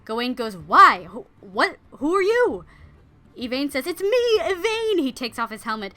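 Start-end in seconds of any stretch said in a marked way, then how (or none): 0.6-2.6 loud, startled questions, rising high; baffled alarm. Retold or imitated. Gawain baffled by the outburst. 3.9-5.0 high, loud impassioned cry; desperate reveal. Retold or imitated. Yvain frantically reveals his identity.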